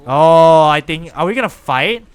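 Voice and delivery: a sudden loud startled oh; startled exclamation. Real, not acted. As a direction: jump at the surprise attack. Sudden fight starts in game.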